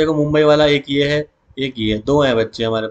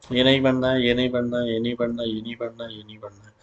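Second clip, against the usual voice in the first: fading repetition; 3 semitones lower, 7 dB quieter than usual. Real, not acted. Repeats phrase while fading away.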